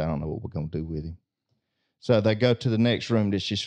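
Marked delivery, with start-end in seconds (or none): 0.0-1.2 low, fast and much softer, under the breath; baffled mutter. Acted. Parents shutting the door on their crying son, muttering in defeat. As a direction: mumble it like a parent out of ideas.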